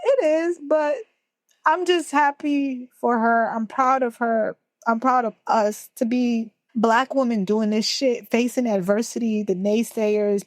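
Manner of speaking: talking through leftover tears, proud; tearful pride after crying; still teary. Real, not acted. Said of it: "It's okay to cry".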